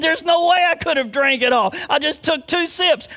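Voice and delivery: hammed-up protesting shout; mock indignant protest. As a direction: act out a loud self-defense. Retold protest to wife.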